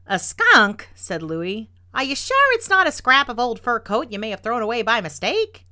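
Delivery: loud, high surprised question; startled disbelief. A narrator's reading. Neighbor Louis hearing of the skunk, startled. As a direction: echo it back in surprise.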